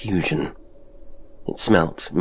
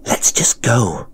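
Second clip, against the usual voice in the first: drawn-out, forceful stage whisper, higher; hissed; 4 semitones higher, 4 dB louder, slower than usual. A narrator's reading. Frightened visitor begging Jonas to leave the cave, hissed. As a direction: hiss the plea slowly and hard. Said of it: "I hissed at him".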